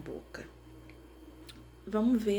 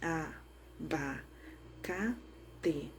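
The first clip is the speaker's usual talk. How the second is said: "A, ba, ca, te" as four separate beats; syllable by syllable; 5 dB quieter, slower than usual. Shown as a demonstration. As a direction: say "A, ba, ca, te" with a pause between syllables. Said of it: "Eu vou bater palminhas". ("A, ba, ca, te" em sílabas separadas)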